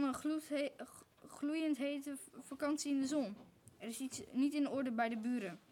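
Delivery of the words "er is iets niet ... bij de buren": plain reading voice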